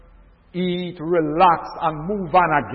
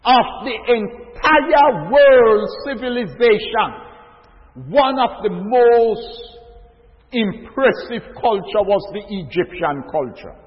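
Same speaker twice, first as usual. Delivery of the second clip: raised, slow preacher's build to the congregation; preaching; 4 semitones higher, 6 dB louder, slower than usual. Acted. Preacher rousing his brothers and sisters, slow and raised. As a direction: lean into every phrase like a pulpit sermon.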